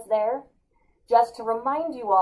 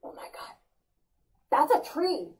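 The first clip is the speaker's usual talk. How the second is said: whispered then awed; 7 semitones higher than usual. Retold or imitated.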